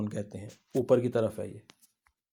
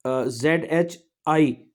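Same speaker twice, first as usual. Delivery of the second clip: slow, careful spelling of Z एच आई; 10 dB louder, much slower than usual. Shown as a demonstration. Teacher slowly spelling the syllable zhi for students. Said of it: "ये combination है".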